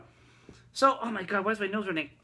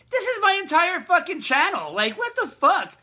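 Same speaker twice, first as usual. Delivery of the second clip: loud, high-pitched indignant outburst; incredulous and raised, slowing on the swearing; 9 semitones higher, 6 dB louder than usual. Real, not acted. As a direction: protest loudly, voice jumping up. Rejects friend's advice about video.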